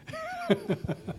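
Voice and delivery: short embarrassed laugh; sheepish laugh. Real, not acted. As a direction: laugh it off shyly. Embarrassed laugh about own pronunciation. (verlegenes Lachen)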